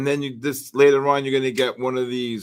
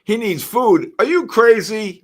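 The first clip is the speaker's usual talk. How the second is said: playful dog talk; 8 semitones higher, 6 dB louder than usual. Real, not acted. Teasing talk about his dog.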